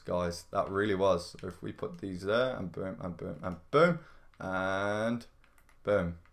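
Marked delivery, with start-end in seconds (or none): none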